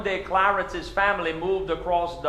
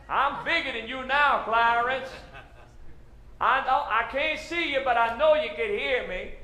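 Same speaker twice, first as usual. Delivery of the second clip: calling across the bayou; 6 semitones higher than usual. Retold or imitated. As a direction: stretch it out like yelling over a river. Yelling across the bayou as adult.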